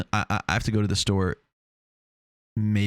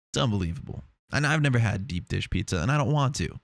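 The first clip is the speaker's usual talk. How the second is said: ordinary talk, nothing marked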